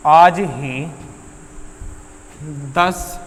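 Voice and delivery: long pauses between words, softening; falling away piece by piece; word-by-word dictation. Real, not acted. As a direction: dictate slowly as if writing it out. Dictating sentence while writing it.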